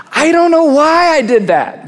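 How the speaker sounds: a lightly voiced puzzled self; mock confession. People puzzling over their own choices, mildly baffled. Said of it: "This is why we say".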